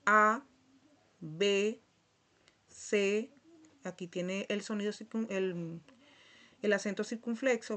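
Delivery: letters said slowly one by one; letter-by-letter demo. Shown as a demonstration. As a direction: call out each letter like a teacher. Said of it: "Aquí está la letra y la pronunciación".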